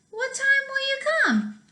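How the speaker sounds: very high squealing question; squeaky little pig. Acted. Little pig asks the wolf the time, squealing high.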